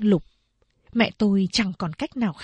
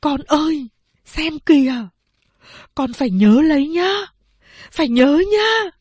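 higher, louder earnest urging; mother urging child; 6 semitones higher, 5 dB louder than usual. A narrator's reading. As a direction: press the lesson on the little one. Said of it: "khẽ bảo với nó rằng". (mẹ dặn đứa bé phải nhớ, giọng cao và to)

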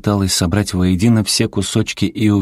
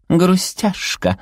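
high, drawn-out pouty creature voice; cute pout; 13 semitones higher, much slower than usual. A narrator's reading. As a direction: pout it slowly in a tiny high voice. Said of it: "очаровательно надула губки". (капризно-милый высокий голосок, протяжно)

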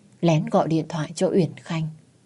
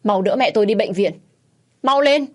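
high, desperate command building louder; frantic cry, climbing in pitch; urgent shout; 4 semitones higher, 4 dB louder than usual. A narrator's reading. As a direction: yell for immediate help. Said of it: "gào lên".

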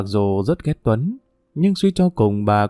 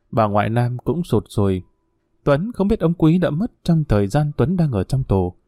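ordinary delivery

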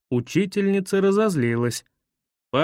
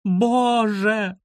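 high, long-held dramatic appeal; imploring cry; 6 semitones higher than usual. A narrator's reading. Guide despairs of the children, imploring cry.

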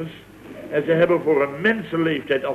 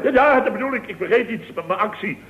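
mock servile fawning; 7 semitones higher than usual. Acts servile toward Paul as a bit.

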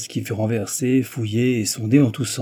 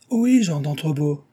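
higher-pitched hail, drawn to carry; calling out; 6 semitones higher, 3 dB louder than usual. A narrator's reading. Guests hail Jean inside the closed chair, calling out. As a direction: call out as if to someone out of sight. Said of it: "crièrent-ils".

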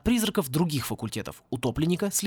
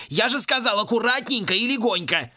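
higher, exasperated rebuke; thundering scolding; 6 semitones higher than usual. A narrator's reading. Caretaker to student who broke the statue, thundering. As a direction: scold hard, punching each word. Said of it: "загремел над Гаттером голос Клинча".

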